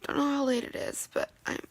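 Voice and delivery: softly crying alone late at night; real teary. Real, not acted. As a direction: speak quietly through real tears at five in the morning. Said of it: "I am so in tears".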